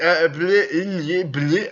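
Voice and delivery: tongue-tied bleh noises; garbled gibberish. Acted. Cursed rival asking permission to kiss, garbled blubbering. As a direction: stammer out nonsense as if the tongue is tangled. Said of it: "trying and ask you for permission".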